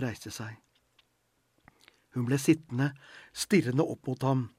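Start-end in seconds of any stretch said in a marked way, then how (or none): none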